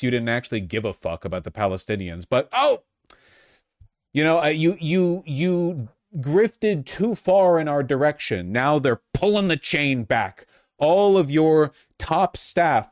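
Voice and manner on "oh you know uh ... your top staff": high, loud, drawn-out taunt; sarcastic, pitched-up telling-off; mock scolding. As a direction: rub it in with exaggerated mock dismay. Mocking scolding of absent politician.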